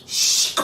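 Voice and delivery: sharp voiceless whip swish; loud hissed whip sound; whip crack. A narrator's reading. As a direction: imitate a lash cutting the air. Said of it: "came with a whip".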